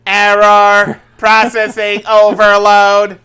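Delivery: loud, slow robot error voice; malfunctioning computer, hammed-up; computer processing. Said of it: "are you the computer from".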